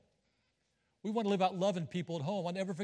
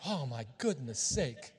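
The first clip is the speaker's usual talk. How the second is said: laughing exasperation; 6 semitones lower, 5 dB quieter, slower than usual. Real, not acted. Preacher reacts to the church clock.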